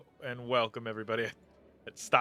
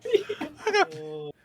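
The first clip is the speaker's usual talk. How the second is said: crying with laughter; laughing too hard to talk; 9 dB louder, slower than usual. Real, not acted.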